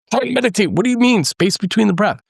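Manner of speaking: high, baffled, mildly annoyed retort; exasperated self-quote. Retold or imitated. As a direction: bristle at being told to find the space. Frustrated retreat student mocking teacher.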